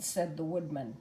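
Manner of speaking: ordinary delivery, nothing marked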